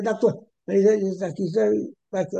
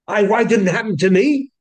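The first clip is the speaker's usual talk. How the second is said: louder, pained question; rabbi's anguished outcry; 7 dB louder than usual. Retold or imitated. Rabbi asking why the punishment missed him, loud outcry. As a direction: cry out why it struck him and not you. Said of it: "he said".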